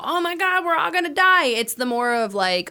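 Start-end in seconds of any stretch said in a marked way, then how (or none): 0.0-1.5 high-pitched hammed-up panic; mock freak-out. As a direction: imitate everybody freaking out, pitched up. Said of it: "everybody's freaking out about".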